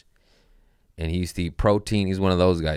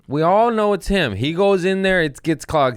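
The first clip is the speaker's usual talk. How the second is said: loud, high-pitched exasperation; worked-up, high and insistent; exasperated insistence; 10 semitones higher, 4 dB louder than usual. Real, not acted. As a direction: raise your voice at the obvious. Exasperated that culprit is obvious.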